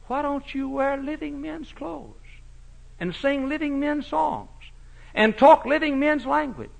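high, rising in volume; building preacher's cry. Acted.

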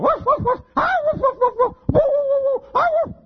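high, loud yapping dog barks; yappy chihuahua bark. Retold or imitated. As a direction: yap like a little chihuahua. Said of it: "the dog comes out going".

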